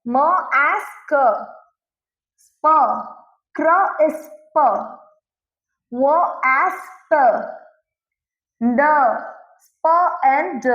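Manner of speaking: isolated consonant blends, each held apart; sounding out blends. Shown as a demonstration. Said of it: "reciting blend sounds".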